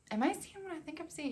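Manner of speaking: high, hushed disbelief; unsure, hushed. Retold or imitated. Mom peering at the ultrasound screen, unsure and hushed. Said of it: "unsure what she was looking at".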